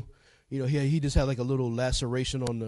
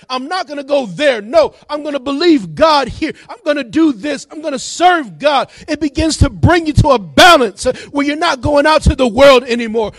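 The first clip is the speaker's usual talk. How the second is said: loud, high, driving sermon delivery; fired-up preacher in full flow; full-force preaching; 8 semitones higher, 13 dB louder than usual. Real, not acted. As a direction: build to a shouted declaration of faith.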